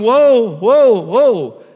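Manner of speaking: loud, high herding shout; second shepherd calling his sheep loudly. Retold or imitated. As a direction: shout the flock over to you. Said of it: "starts shouting".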